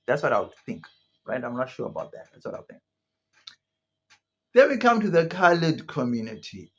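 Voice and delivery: ordinary talk, nothing marked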